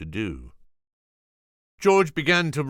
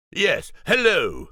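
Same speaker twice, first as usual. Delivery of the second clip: George's voice; 10 semitones higher, 5 dB louder, slower than usual. Acted. George's nervous reply.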